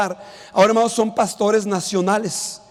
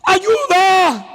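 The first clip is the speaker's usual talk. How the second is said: high, loud desperate cry; shouted for help; 8 semitones higher, 4 dB louder, much slower than usual. Retold or imitated. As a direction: call out as the drowning man's witness. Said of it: "un hombre empezó a gritar". (grito desesperado de auxilio)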